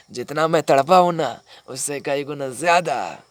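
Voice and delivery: loud, rising vengeful declaration; vengeful vow. Acted. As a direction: swear revenge forcefully, stretching the end. Vows revenge on his brother. (बदले की ज़ोरदार कसम)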